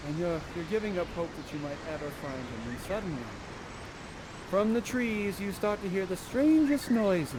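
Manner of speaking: very soft, suspenseful storytelling; hushed scene-setting. Acted. Storyteller hints at noises from the trees, hushed suspense. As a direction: draw them in with a hush. Game master sets eerie scene.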